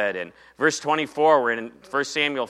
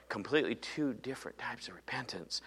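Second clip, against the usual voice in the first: whisper; 14 dB quieter than usual. Real, not acted. Aside after David's prayer.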